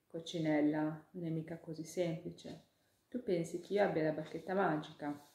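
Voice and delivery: ordinary delivery, nothing marked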